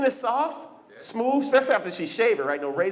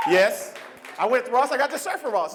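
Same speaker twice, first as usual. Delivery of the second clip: ordinary talk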